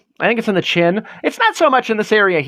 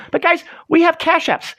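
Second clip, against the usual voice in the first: ordinary delivery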